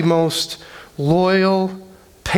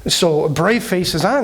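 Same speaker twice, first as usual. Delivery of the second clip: ordinary delivery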